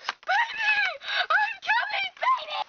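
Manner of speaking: put-on very high shout to a child; frantic calling out. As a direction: call out high, rushing to the rescue. Mother calls out to daughter.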